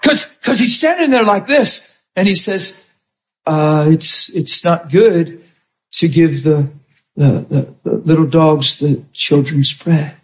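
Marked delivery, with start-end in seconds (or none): 3.4-10.1 lightly voiced Jesus. Jesus answers the woman.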